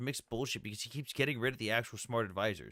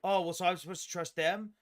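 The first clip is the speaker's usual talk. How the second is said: higher-pitched mock incredulity; imitating skeptical opponent; 7 semitones higher than usual. Host retelling an opponent distrusting the Navy, mock incredulous. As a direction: echo an opponent's scornful question in a raised pitch. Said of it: "He goes".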